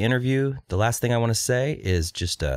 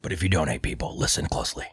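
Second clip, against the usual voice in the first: a mild conspiratorial whisper-rant; hint of a paranoid radio host leaning in; Alex Jones impression; 3 semitones lower, 5 dB quieter, faster than usual. Podcast host mocks donors, as Alex Jones, hushed. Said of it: "I'm Alex Jones".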